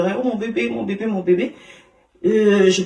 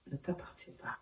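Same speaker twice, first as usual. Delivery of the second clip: whispered, softer, God's voice inside her; 23 dB quieter than usual. Retold or imitated. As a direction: breathe it like a secret. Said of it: "Il me dit".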